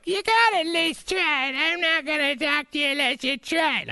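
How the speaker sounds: high put-on nagging wife voice; mock wife. Host imitating his wife nagging him, in a high put-on voice. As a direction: squeak it like an insistent wife. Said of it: "my wife was like".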